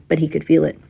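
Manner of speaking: ordinary delivery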